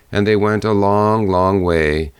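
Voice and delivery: ordinary delivery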